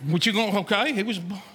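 offhand, lightly dismissive repeat; underwhelmed. Acted. Preacher mimics reaction to the yearly Christmas message, underwhelmed. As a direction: shrug it off as old news.

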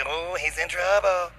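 slow, drawn-out mock teasing; imitated elementary-school "ooh"; schoolkid taunt. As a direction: tease slowly like a kid in class. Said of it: "Like I'm in elementary school".

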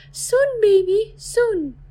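slow, high, holding back a sob; tearful mother. Acted.

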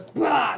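short loud roar, imitating a Wookiee. Retelling Chewbacca busting out of his chains, mock roar. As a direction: burst out with a mock Wookiee bellow. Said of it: "Wookiee goes".

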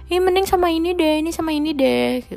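hammed-up gossip pushing a match; a fan nagging celebrities about who to date, in a high whiny mock voice; mock busybody fan. Mocking fans pairing celebrities.

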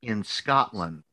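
each phrase stretched and set apart; mock-stern, very slow instructions; slow, deliberate ordering. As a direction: imitate a mentor spelling it out. Retold advisor spelling out orders.

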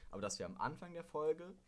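ordinary talk

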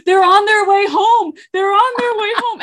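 high, loud panicked yell; frantic yell from across the house; retold yell. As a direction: call out the warning in alarm. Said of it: "I hear her yell".

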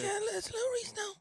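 high, strained wheezy voice; injured voice. Retold or imitated. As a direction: speak through a neck wound. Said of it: "he talks like this".

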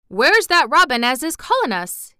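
light up with sudden interest; eager question. Acted. Dickon suddenly hears the robin calling.

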